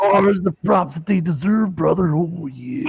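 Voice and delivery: gravelly wrestling announcer yell, cutting to a whisper at the end; Macho Man Randy Savage impression. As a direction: hype it up like a wrestler on the mic. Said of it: "Macho Man Randy Savage".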